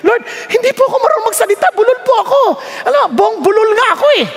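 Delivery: high-pitched, hurried protest to the Lord; mock pleading. Young preacher telling God he cannot speak, mock pleading. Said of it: "nung ako tinawag ng Diyos, ganun ang tanong ko".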